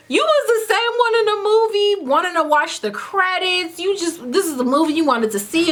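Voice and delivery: high-pitched mock accusation; indignant, high retold call-out; incredulous accusation. Silently accusing date in retelling.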